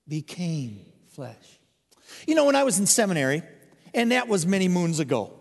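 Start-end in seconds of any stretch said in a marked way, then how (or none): 0.1-1.6 slow, deliberate call-and-response; slow, low unison repetition with the congregation; congregation repeats. Shown as a demonstration. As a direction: say it together, slowly with the people. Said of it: "Would you say that with me, please?".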